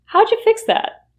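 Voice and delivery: How'd you fix that with howja, pitch lifted; reduced question. Shown as a demonstration. Asking a colleague about the copier, reduced. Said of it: "you can ask".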